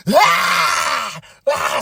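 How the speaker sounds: high, loud mock rage yell; hammed-up tantrum scream. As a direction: scream like she's trashing a room. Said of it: "walks into the locker room".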